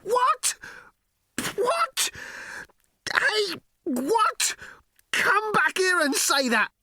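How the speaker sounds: very high, hysterical outrage; screaming. A narrator's reading. Arthur raging after the vanished ship, screaming. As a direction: scream at the empty sky in disbelief. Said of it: "he screamed".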